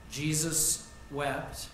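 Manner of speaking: slow, heavy, each word drawn out; slow solemn. Shown as a demonstration. Preacher lets the congregation feel Jesus wept, slow and heavy. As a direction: let Jesus wept sink in slowly. Repeats verse slowly for weight.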